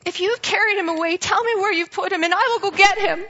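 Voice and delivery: desperate plea. Acted. Mary begging the gardener for her Lord's body, urgent plea. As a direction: beg him to say where the body is.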